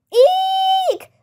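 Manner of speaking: a tiny high-pitched shriek of fright; cartoon scream from the whole group; high squeal. A narrator's reading. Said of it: "we all screamed".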